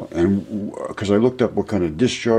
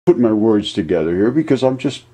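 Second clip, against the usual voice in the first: ordinary talk, nothing marked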